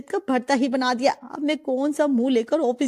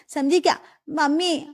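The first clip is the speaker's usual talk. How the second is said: usual speaking voice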